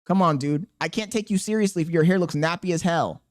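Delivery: ordinary talk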